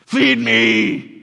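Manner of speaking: loud whiny people begging Moses for meat; hammed-up spoiled children demanding food; whiny demanding crowd. Spoiled Israelites whining at Moses, loud and demanding. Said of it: "They're like".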